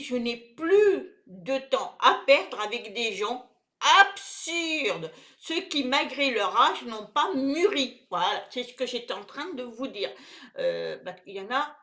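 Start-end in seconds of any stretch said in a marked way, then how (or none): 0.0-8.1 louder, emphatic reading of verse; poem recitation. Acted. Reading viewers a poem about precious time, emphatic recitation. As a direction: recite it firmly, leaning on key words.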